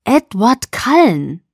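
loud, slow, awed emphasis; amazed exclamation. A narrator's reading. Friend astonished at who asked her out, amazed exclamation.

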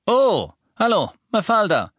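high, bright eager greeting; cheerful little wizard. A narrator's reading. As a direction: greet her like a keen little clerk.

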